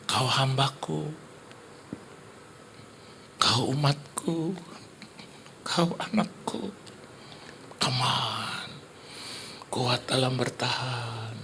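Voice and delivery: soft, very slow, solemn voice; Holy Spirit. Acted. Holy Spirit steadies husband as his child, slow hushed voice. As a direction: draw out each phrase tenderly, hushed. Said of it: "kuasa Roh Kudus".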